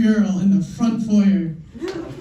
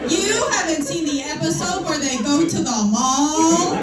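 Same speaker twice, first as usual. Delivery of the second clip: high, slow, whiny fan voice mocking friends; drawn-out nagging superfan impression; mocking a nerdy fan; 8 semitones higher than usual. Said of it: "motherfuckers would go".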